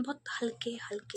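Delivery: whispered softly. Shown as a demonstration. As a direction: breathe it in a whisper. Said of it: "प्यार से बात करनी है". (फुसफुसाकर बहुत हल्के)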